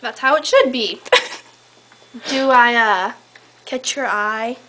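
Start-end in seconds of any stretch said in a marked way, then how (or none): none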